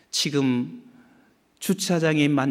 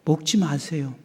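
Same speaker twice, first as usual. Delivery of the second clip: usual speaking voice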